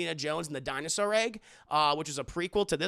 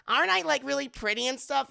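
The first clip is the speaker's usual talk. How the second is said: higher fishing for compliments; vain Willie; 6 semitones higher, 4 dB louder than usual. Retold or imitated. Willie asking Indy if she's pretty, higher, preening. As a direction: preen and beg for praise.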